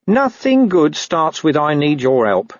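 a touch of grudging wariness, drawn out; lightly voiced wary dog. Dog distrusting a plea for help, slow and wary.

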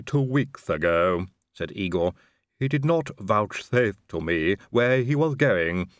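slow, lisping servant drawl; a creepy, lisping manservant; Igor's voice. A narrator's reading. Igor answers Inigo.

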